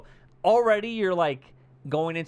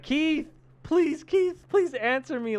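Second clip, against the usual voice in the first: high, loud, drawn-out plea; acted pleading; 5 dB louder, slower than usual. Heroine begging her companion in the basement, acted pleading call. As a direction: imitate a scared woman begging for an answer. Heroine begs man to answer.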